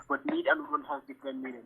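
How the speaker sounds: barely audible, trailing off; mumbled under the breath; too quiet. Real, not acted. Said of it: "can you speak louder".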